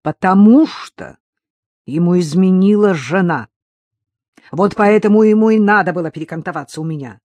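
loud, emphatic revelation; exasperated outburst. Acted. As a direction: blurt it out forcefully. Cornered outburst revealing the secret.

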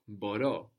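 "Boro" stressed on the last syllable, bo-RO; accent on ending. Shown as a demonstration. Teacher confirming the student's verb, "Boro" with end stress. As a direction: put the stress on the final o of "Boro". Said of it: "has the accent on the ending".

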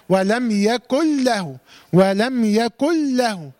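slowly chanted "يكن له" as "yakul-lahu", no nasal hum; merged doubled lam. Shown as a demonstration. Teacher modelling idgham, chanting "يكن له" with one doubled l. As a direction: turn the noon of "يكن" into the lam of "له". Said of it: "هنطق الحرف الاول زي التاني مشدد".

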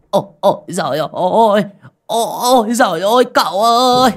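terrified wailing. A narrator's reading. Driver clinging to his master after seeing the ghost, terrified wail. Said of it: "mếu máo".

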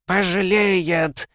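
slow, high piercing bird shriek; shrill screeching bird woman. Acted. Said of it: "пронзительно заверещал Ветрогон". (пронзительный птичий визг)

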